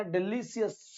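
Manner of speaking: usual speaking voice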